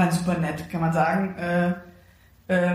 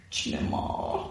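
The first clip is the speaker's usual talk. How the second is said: whispered guilty admission; sheepish whisper; 8 dB quieter than usual. Retold or imitated. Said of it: "dann sag ich so". (schuldbewusst geflüstert)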